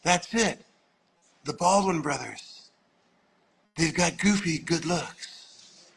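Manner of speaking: slow, spaced-out impression of a revelation; drawn-out, musing pronouncement; mock revelation. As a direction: imitate a dreamy director announcing a great discovery. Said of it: "like he had made a revelation".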